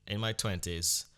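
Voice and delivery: ordinary talk, nothing marked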